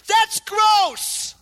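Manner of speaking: high loud cry of disgust. Real, not acted. Preacher reacting to dogs marking trees, high loud disgust. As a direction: recoil from it out loud.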